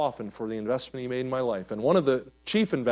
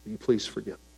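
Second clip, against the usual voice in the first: tender apology; 4 semitones lower, 6 dB quieter than usual. Retold or imitated. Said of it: "I've had to go back to Angela and say".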